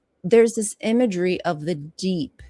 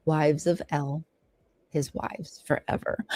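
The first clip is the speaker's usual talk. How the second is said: hushed reverent close; 4 semitones lower, 6 dB quieter than usual. Acted. Reading fades to a hush.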